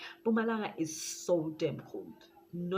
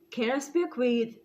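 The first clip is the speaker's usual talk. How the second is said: louder, higher demand on the phone; bold phone request; 6 semitones higher, 8 dB louder than usual. Retold or imitated. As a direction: ask for him firmly. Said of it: "I'm like".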